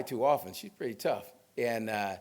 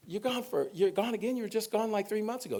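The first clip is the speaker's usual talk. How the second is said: much higher, incredulous mock outrage; mock disbelief; 11 semitones higher than usual. Speaker teasing his retired neighbors about another trip, with high mock disbelief. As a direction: play up the shock at their long trips, pitched way up. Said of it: "I'm like".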